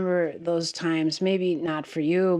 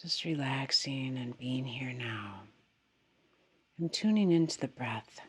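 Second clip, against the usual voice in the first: softer, unhurried meditation voice with long pauses; guided meditation; 3 semitones lower, 4 dB quieter than usual. Real, not acted. Teacher guiding meditators to settle into the body, guided meditation. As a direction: gently settle them, leaving space after each phrase.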